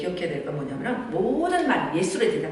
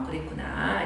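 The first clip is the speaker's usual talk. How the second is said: much lower, softer understanding; gentle empathy; 11 semitones lower, 7 dB quieter than usual. Shown as a demonstration. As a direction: say it soothingly to the child. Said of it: "이렇게 해주고". (조용히 공감하는 엄마)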